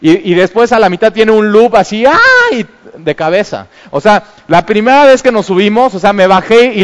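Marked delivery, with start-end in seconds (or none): 2.1-2.7 much higher, loud playful yelp; mock scream. Father mimics screaming in the ride's loop, yelping. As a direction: yelp like upside down on the ride.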